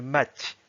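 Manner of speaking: "mat" with a softened "tʲ" ending; palatalised final T. Shown as a demonstration. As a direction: let the T of "mat" slide towards "ty". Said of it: "là, on note bien le".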